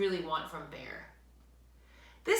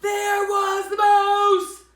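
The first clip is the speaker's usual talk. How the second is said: dramatic surprise; 13 semitones higher, 15 dB louder, slower than usual. A narrator's reading. Narrator building surprise.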